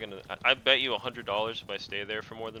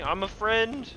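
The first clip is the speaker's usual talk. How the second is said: calling out; 11 semitones higher, 3 dB louder, much slower than usual. Real, not acted. Shot by a teammate.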